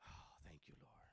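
breathless whisper to the Lord; whispered thanks. Real, not acted. Preacher whispers thanks to God after the climax. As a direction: hush it out as a prayer.

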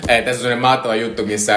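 usual speaking voice

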